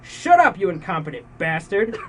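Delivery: high, loud furious yell; angry shout. Acted. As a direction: snap at him in rage. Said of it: "Pengel shouted".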